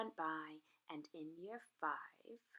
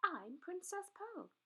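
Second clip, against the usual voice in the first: higher, quicker playful offer; higher playful princess; princess girl's voice; 9 semitones higher than usual. A narrator's reading. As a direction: invite him brightly. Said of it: "she said".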